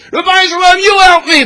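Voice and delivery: high, loud pleading yell. Retold or imitated. Father calling Rabbi Shalom for help, loud high yell. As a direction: yell at the top of your lungs. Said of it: "he yells at the top of his lungs".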